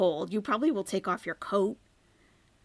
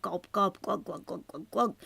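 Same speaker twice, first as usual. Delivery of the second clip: gulping sounds. Retold or imitated. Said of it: "pay attention to this sucking of juice sound".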